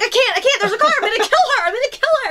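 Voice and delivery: high-pitched mock panic; retold freak-out. Driver scared for the pregnant passenger, high acted panic. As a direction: act out panicking at the wheel. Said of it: "I'm, like, freaking out".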